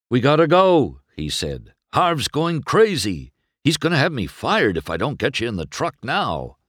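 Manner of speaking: high, loud, rushed urging; anxious driver pressing to leave; urgent hurry. Acted. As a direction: hustle him out fast, no time to lose. Mac rushes McQueen to leave.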